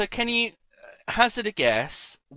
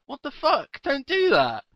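startled outburst; 9 semitones higher than usual. Real, not acted.

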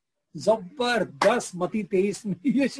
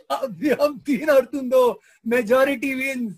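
laughing; 5 semitones higher, 5 dB louder, slower than usual. Acted. Punchline, laughing through it.

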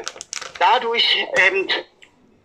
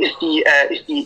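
ordinary delivery

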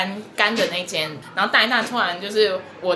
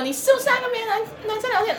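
jealous accusation; 12 semitones higher, 3 dB quieter, faster than usual. Retold or imitated. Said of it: "然后他就说什么".

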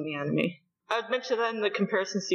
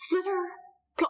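mock beetle flight noise; 11 semitones higher than usual. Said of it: "sound effects in retelling".

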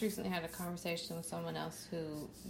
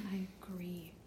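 whispering; 6 dB quieter than usual. Real, not acted. Whispered aside while searching.

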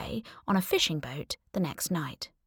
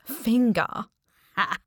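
"Finger!" blurted with a little laugh, a bit higher; amused exclamation; 3 semitones higher, slower than usual. Real, not acted. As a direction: say "Finger!" as if it's a silly name. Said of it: "made me snort with laughter every time he said it".

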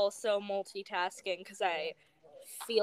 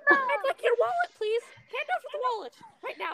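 high squeaky cartoon mouse turning pushy and fast; a mock Mickey squeak getting demanding; Mickey Mouse impression; 13 semitones higher, 3 dB louder than usual. Mickey impression for friends, now demanding the wallet. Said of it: "a mediocre Mickey Mouse impression".

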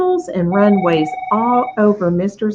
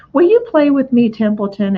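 high, louder eager call; hope the rat says yes; eager piglet; 5 semitones higher, 4 dB louder than usual. Acted.

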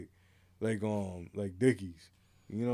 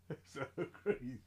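laughing; 14 dB quieter than usual. Real, not acted. Laughing at a friend's joke.

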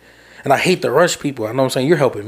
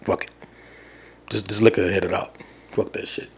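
resigned giving up; 4 semitones lower, 6 dB quieter than usual. Retold or imitated. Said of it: "I'm like".

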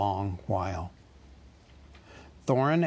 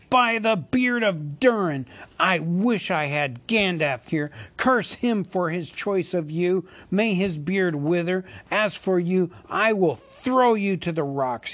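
much higher, louder cursing; raging cry; 6 semitones higher, 4 dB louder than usual. A narrator's reading. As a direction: curse the wizard in a fury. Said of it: "he cried".

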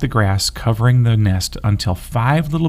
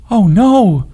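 much higher, louder frightened scream; 10 semitones higher, 5 dB louder than usual. A narrator's reading. Bunny spotting the beast's shadow, panicked scream. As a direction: shriek it in panic. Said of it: "screamed a bunny".

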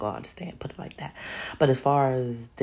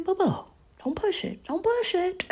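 high, odd voice warning her off; reptilians warning. Retold or imitated. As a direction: warn her sweetly not to push it. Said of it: "I know they saying".

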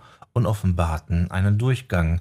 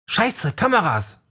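much higher startled exclamation; alarmed cry; 11 semitones higher than usual. A narrator's reading. Leroy spots the camera and cries out, alarmed. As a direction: blurt out the warning in a fright. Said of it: "rief Leroy".

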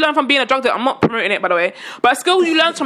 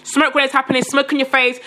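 usual speaking voice

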